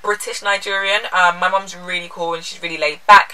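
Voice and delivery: ordinary talk, nothing marked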